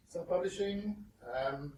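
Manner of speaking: usual speaking voice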